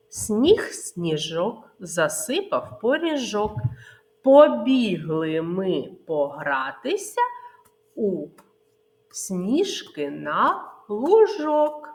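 put-on rhythmic children's finger-game chant; nursery rhyme. Teacher chants finger game rhyme to children, sing-song. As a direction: bounce the rhyme for little ones.

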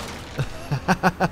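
high staccato laugh; high-pitched chuckling burst; rising laugh. Real, not acted. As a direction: laugh in a quick rising run. Laughs before signing off.

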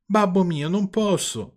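high-pitched mock crying; tearful. A narrator's reading. Puppet on the floor answers father, tearful. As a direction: whine it like a weepy storybook puppet. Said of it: "rispose il burattino piangendo".

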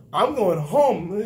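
slow, loud, stubborn declaration; defiant reply. Retold or imitated. Runaway recruit answering the instructors: slow stubborn announcement. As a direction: drag it out like you've made up your mind. Kid calmly defying instructors.

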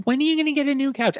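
very high, fast mock question; mocking people asking. Host imitating viewers nagging about her couch. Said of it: "people are like".

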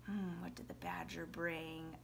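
very soft, low musing; quiet, low thinking-aloud question; pretend pondering. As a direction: mull it over under your voice. Muses over the question, waiting.

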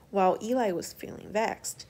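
ordinary delivery

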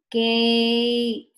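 "Gate" stretched out loudly; slow. Shown as a demonstration. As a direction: hold the long A in "Gate", level and loud. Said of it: "Say it slowly".

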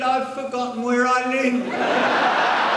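high, drawn-out tearful wail; crying old man. Acted. Old man answering why he weeps, crying. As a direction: stretch it into a mock sob. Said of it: "What are you crying for?".

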